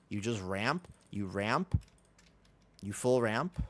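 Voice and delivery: ordinary talk, nothing marked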